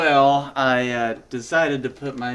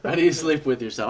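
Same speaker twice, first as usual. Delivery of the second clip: mock repeat; 3 semitones higher, 4 dB louder, faster than usual. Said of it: "Is that the phrase?".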